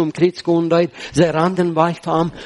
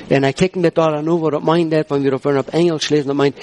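normal talking voice

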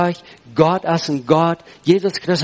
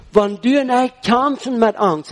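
ordinary talk

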